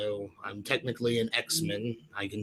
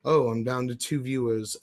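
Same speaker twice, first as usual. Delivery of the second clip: ordinary talk, nothing marked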